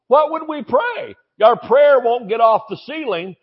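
ordinary talk, nothing marked

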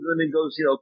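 usual speaking voice

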